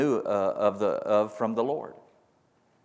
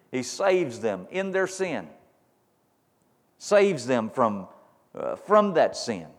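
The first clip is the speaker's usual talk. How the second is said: slow emphatic preaching; 3 semitones higher, slower than usual. Real, not acted. Preaching at full force.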